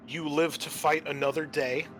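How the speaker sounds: usual speaking voice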